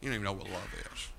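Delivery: normal talking voice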